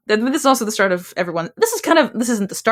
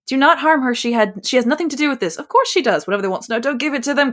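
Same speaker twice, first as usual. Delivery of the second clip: higher, pleading, lightly acted; pleading character voice; 7 semitones higher than usual. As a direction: plead for mother's safety. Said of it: "I've done a bad impression voiceover of".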